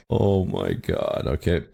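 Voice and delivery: ordinary talk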